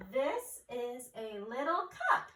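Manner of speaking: loud, drawn-out exclamation; excited. Shown as a demonstration. Teacher rereads a sentence with an exclamation point, excited. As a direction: exclaim each word slowly with excitement. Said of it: "we're gonna be excited".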